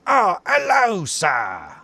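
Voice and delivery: very high, loud cry falling away on sir; mock-polite greeting blasted at full volume; startlingly loud greeting. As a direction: imitate the attendant's booming ambush hello. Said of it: "that's how loud he says it".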